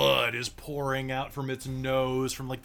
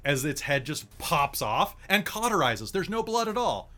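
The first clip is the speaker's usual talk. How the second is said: ordinary delivery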